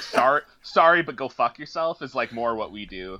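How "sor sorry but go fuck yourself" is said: a loud, slow, drawn-out sorry, then a snide brush-off; mock rude apology. Guest mocks Albertans' fake politeness with a loud, slow sorry. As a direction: ham up a fake polite apology that turns into an insult. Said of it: "Alberta's the dickheads of Canada".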